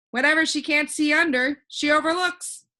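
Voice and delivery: put-on a cheerful know-it-all boy floating in the air; voiced boy Alec. Alec punchline.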